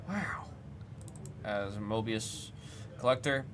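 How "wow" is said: breathless high whispered wow; awed whisper. Real, not acted. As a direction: whisper it in awe. Awed at a pulled card.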